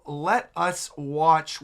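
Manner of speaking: ordinary talk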